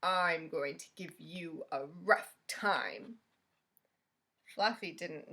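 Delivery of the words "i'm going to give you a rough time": slow, low, threatening rhino voice; big bully rhino voice; menacing rhino. A narrator's reading. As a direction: loom over the little porcupine. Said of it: "said the rhinoceros".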